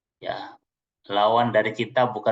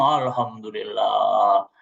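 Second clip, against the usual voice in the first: grateful sigh; 4 semitones lower, 4 dB louder, much slower than usual. Acted. Grateful husband voiced.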